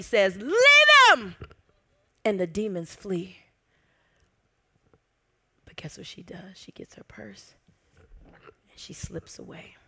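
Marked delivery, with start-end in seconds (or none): 0.4-1.4 very high, loud authoritative command; commanding shout. Retold or imitated. Jesus commanding the demons to leave, loud shout. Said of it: "Jesus, with all authority". 5.6-9.8 hushed storytelling whisper. Acted. Preacher telling how Mary sneaks away, whispering.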